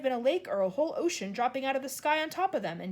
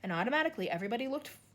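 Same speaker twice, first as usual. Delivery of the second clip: usual speaking voice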